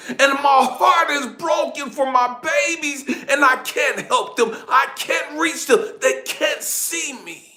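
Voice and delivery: high, loud, helpless outcry; anguished lament. Retold or imitated. Father hovering unseen above his babies, anguished. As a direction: lament at full voice, heartbroken.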